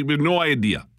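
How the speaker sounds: ordinary delivery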